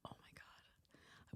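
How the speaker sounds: breathless whisper of dawning horror; whispered realization. Retold or imitated. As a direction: whisper it as it hits you.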